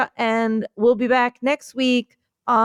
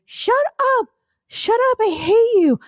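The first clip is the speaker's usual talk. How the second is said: higher squeaky shoe snapping back; the first shoe fights for its turn; first shoe, bickering; 12 semitones higher than usual. Pretend. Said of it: "I'm a shoe".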